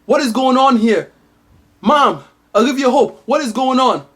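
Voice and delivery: high, mock-demanding prince; prince storming in. Prince storming into the crying room, mock-demanding and high. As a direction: barge in demanding answers. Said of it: "he goes".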